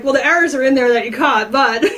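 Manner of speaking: retells the author's reply through a real laugh; laughing while quoting. Retold or imitated. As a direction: chuckle through the words, amused. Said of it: "he's, like, laughing".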